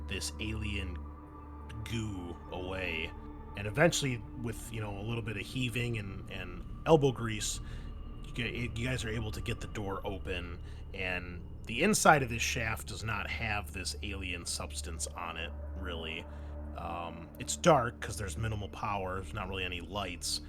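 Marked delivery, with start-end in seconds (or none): none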